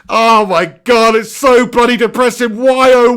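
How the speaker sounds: ordinary talk, nothing marked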